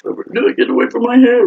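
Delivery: loud squealed mock protest; high-pitched protest. Acted panic in packed car.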